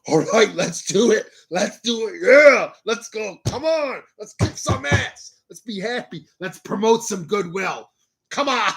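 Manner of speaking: pumped, high-pitched cheerleading; over-caffeinated hype man; hyped-up energy-drink self. Retold or imitated. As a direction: bounce off the walls like after two energy drinks. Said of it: "And then I'm just like this".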